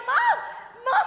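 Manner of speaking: high-pitched frantic call; retold scream. Daughter lost in the water calling mom, high scream. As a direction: imitate screaming for a lost mother. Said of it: "I'm screaming, like".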